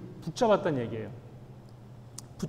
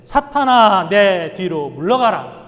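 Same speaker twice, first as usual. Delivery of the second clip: stern shouted rebuke; 13 dB louder, slower than usual. Retold or imitated. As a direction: thunder it as a command to drive Satan away. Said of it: "아주 단호하게". (베드로를 꾸짖는 예수님, 크고 단호한 호통)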